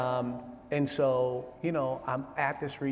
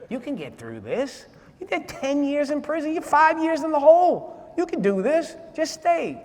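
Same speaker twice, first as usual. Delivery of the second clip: God's voice urging; 7 semitones higher, 3 dB louder than usual. Retold or imitated. God on the other shoulder urging him to stay, building from hush to loud. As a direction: start as a hush, then rise into a rallying plea. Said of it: "I'm hearing God in the other one".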